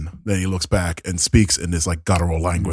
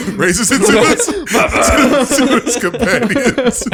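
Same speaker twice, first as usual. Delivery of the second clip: breaking up with laughter, much higher and louder; laughing; 5 dB louder than usual. Real, not acted. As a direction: giggle through the narration. GM cracks up at muffins.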